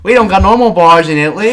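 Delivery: shouting, high and fast, mock outrage; loud comic rant. Real, not acted. Podcast host ranting to his co-host about Italian bars, loud and outraged. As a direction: yell it like an outraged tourist.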